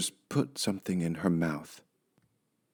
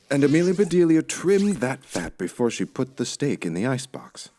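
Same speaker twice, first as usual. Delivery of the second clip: ordinary delivery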